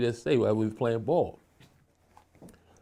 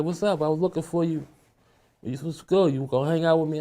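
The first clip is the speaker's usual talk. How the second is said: a lightly voiced girl, pitched higher; girl's voice; 6 semitones higher than usual. Girl asking him to hang out, lightly raised pitch. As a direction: lightly play the girl who walked up. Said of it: "She's like".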